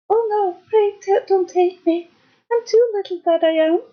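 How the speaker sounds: high, tiny pleading goat; small-voiced little billy goat. A narrator's reading. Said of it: "with such a small voice".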